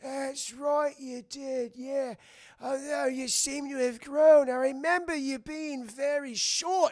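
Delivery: put-on high, very slow old rat voice; drawn-out doddery pirate; ancient cabin boy. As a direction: reminisce like a doddering old sailor. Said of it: "He's like".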